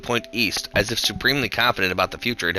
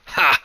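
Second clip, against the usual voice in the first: one loud, higher bark of a laugh; 6 semitones higher, 4 dB louder than usual. Acted. Old woman laughing at the fleeing bully, one barked laugh. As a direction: bark a single scornful laugh. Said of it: "barked a single laugh".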